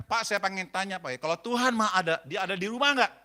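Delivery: forceful acted questioning; loud challenge. Husband springing his third question on the pastor, challenging. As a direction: build to the loud testing question.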